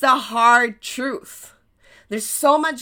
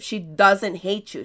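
normal talking voice

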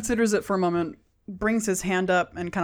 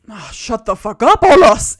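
irritated outburst, rising to a shout; angry shout; 12 dB louder than usual. Acted. Thug silencing the halfling he is about to punch, angry shout. Thug snaps at his captive.

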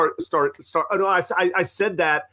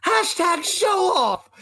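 high, slow drawn-out call; imitating the friend; 12 semitones higher, slower than usual. Friend retelling his buddy calling at the wrestler, slow mock shout. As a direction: call it out like a proud show-off. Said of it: "He goes".

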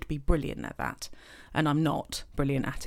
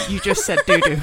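loud laughing tease; 2 semitones higher, 10 dB louder than usual. Real, not acted. Teasing her friend for saying doo-doo, laughing loudly. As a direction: shout it through laughter.

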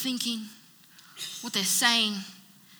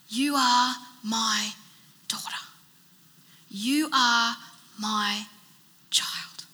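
tender slow naming; much slower than usual. Acted. Jesus tenderly naming her.

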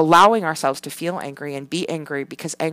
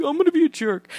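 put-on higher kid voice, blurting; imitating kid answer; 11 semitones higher, faster than usual. Son says he'll become a jerk, mock high kid voice. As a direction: answer like a proud five-year-old.

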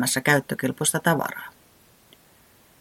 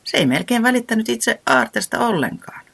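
usual speaking voice

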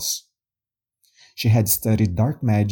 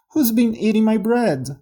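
high little dwarf voice; squeaky dwarf; 10 semitones higher than usual. A narrator's reading. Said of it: "The third one".

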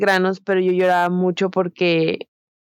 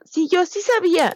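mocking know-it-all; 12 semitones higher than usual. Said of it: "Y el que diga".

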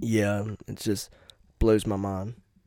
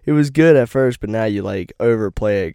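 louder mock of girlfriend's gripe; girlfriend complaining; 7 dB louder than usual. Retelling his girlfriend's complaint about the song, louder. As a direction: whine it like the annoyed girlfriend. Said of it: "She's like".

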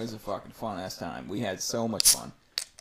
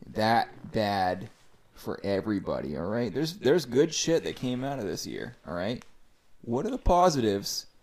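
ordinary talk, nothing marked

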